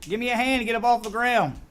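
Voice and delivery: mock king shouting for help from the ground; calling out. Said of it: "Oh, you would imagine he'd been".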